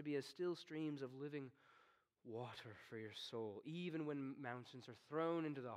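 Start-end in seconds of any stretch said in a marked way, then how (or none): none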